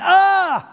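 a sudden high yelp of pain, loud; boy's loud ouch of pain; pained yell. Retold or imitated. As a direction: cry out as the knee gives way. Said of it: "he tripped and he hurt his knee".

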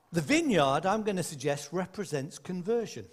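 ordinary talk, nothing marked

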